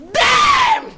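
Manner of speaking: very high, loud outburst; furious shout. Acted. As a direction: explode on the single word. Betty explodes in anger.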